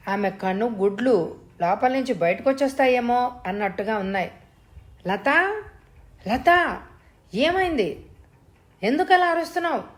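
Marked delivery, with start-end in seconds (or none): 5.1-9.8 higher, rising panic as he questions her; worried husband, pitch climbing with each question; flustered alarm. A narrator's reading. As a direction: call her name, then ask urgently in a high, anxious voice. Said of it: "కంగారుగా అడిగాను".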